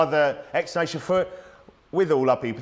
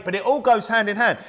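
normal talking voice

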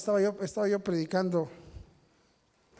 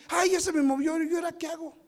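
higher, louder fretting wife voice; flustered wife; 9 semitones higher, 3 dB louder than usual. Retold or imitated. Preacher imitating his wife panicking over her phone, flustered. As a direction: play the wife who can't work her phone.

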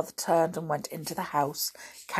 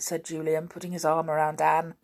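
usual speaking voice

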